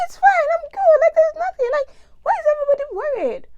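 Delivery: very high, loud mock cheerfulness; naive reassurance. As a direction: insist shrilly in imitated carefree innocence. Acts out reassuring worried people back home.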